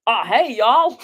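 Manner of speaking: a badly faked American accent, brightly greeting; mock an American "hey y'all" and miss; failed American accent. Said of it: "I can't do an American accent".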